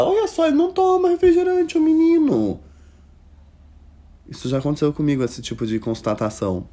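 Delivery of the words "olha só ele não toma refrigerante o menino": very high falsetto auntie voice; shrill high fussing relative talking about the boy; mock gossipy auntie. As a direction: squeal it like a nosy old aunt.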